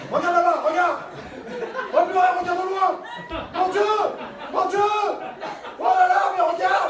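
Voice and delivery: high, loud awestruck cries; excited shouting. Acted. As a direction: shout in wonder at the view. Pilot marvels at the view, shouting.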